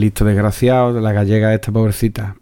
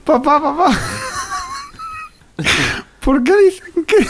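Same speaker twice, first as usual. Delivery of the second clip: put-on child voice. Host voicing a child asking his father, very high and whiny. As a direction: whimper for dad like a little boy. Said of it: "Parezco gallego".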